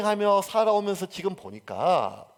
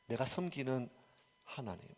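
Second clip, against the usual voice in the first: much softer, fading to near silence; reverent hush; 5 semitones lower, 11 dB quieter than usual. Acted. Preacher quietly ending Paul's confession to the congregation. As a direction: let it fall into a whisper of devotion.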